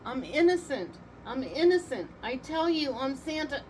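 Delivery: high, loud desperate plea; pleading. A narrator's reading. Santa pleading at his trial, high and loud. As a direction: beg the court, desperate. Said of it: "Santa pleaded".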